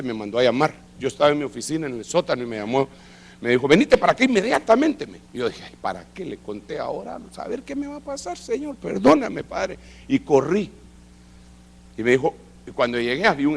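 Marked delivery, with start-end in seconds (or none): 3.7-5.1 high, sharp call to come now; urgent summons. Retold or imitated. Boss summons him urgently. 5.6-9.8 nervous worker praying before facing the boss, anxious; nervous prayer. Retold or imitated. Frightened inner prayer after summons.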